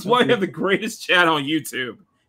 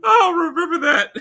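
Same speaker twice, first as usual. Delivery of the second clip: laughing; 9 semitones higher, 5 dB louder than usual. Real, not acted. Laughing too hard to speak.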